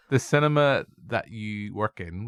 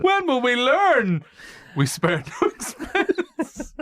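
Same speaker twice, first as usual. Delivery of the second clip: very slow, higher, louder wail; hammed-up lament; 5 dB louder, much slower than usual. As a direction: ham up a tragic host's lament. Hammed-up lament about escapees.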